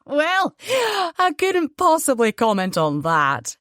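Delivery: high laughing Edinburgh voice, falling as it goes; laugh, then squeeze the words out giddily; near-hysterical laughter. A narrator's reading. Banker reacts to the huge sum, near-hysterical laughter. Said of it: "laughed on a note of near hysteria".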